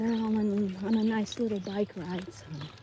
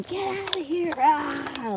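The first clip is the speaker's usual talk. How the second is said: retold yelling like a monster; 7 semitones higher, slower than usual. Neighbor charging out at the raccoon, mock high yell. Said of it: "yelling, like a monster".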